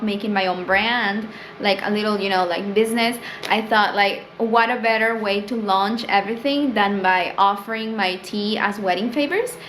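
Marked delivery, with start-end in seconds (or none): none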